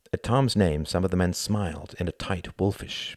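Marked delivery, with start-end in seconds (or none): none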